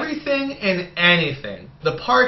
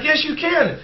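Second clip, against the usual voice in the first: mock insistent wife; 9 semitones higher than usual. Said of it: "and she's like".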